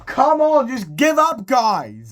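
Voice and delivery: very high, loud mock plea; exasperated cry. Ben pleading with defenders, loudly exasperated. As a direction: burst out in acted exasperation, dropping at the end.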